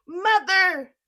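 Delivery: loud, high yell down below. Acted. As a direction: call out to mother in panic. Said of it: "he yelled".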